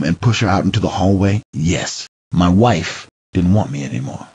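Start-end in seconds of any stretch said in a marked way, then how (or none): none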